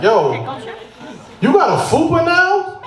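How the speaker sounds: high-pitched incredulous question; teasing friend. Acted. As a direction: call it out high, needling a friend. Friend teases him in a quote.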